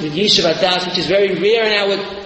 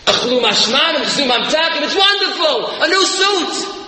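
climbing higher and higher with joy; festive excitement; 8 semitones higher than usual. Acted. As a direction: build the celebration up to A new suit. Festive joys of Rosh Hashanah.

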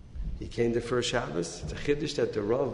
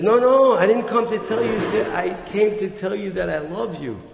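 eager protest; 6 semitones higher, 6 dB louder than usual. Acted. [name] answers the shouting owner.

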